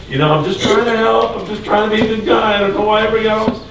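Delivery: high-pitched mock apology, hurrying towards the end; imagined backpedalling, pitched well up; placating excuse. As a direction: plead your way out, trying to calm everyone. Imagined apologetic Jesus talking his way out.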